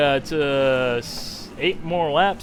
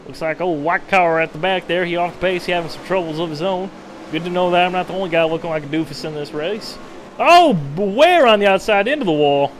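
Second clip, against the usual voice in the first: hammed-up Southern race announcer, louder; fake Southern accent; 3 dB louder than usual. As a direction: play a good old boy calling the race in a fake Southern accent. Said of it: "Do a good Southern accent".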